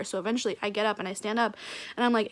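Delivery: ordinary talk, nothing marked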